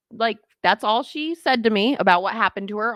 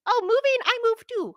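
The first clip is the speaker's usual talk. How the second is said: put-on little kid voice. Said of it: "and I just like".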